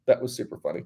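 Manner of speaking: usual speaking voice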